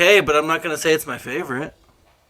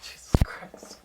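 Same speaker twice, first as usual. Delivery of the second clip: whispered under the breath; exasperated whisper; 11 dB quieter, slower than usual. Real, not acted. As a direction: breathe it out in frustration.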